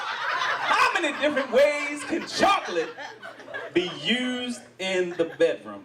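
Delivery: teasing slow reread. Shown as a demonstration. Host rereading the chocolate question to the crowd, teasing. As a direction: drawl it out suggestively. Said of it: "That's how I'd like to start".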